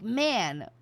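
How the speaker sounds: ordinary delivery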